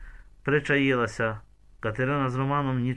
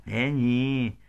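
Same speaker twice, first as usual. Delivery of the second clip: ordinary delivery, nothing marked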